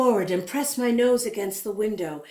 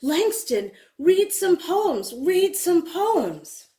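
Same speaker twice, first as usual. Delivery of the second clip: high, loud, slow call; a friend calling out for poems; 8 semitones higher, 4 dB louder, slower than usual. A narrator's reading. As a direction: call to him across the room, slow. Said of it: "A friend calls out".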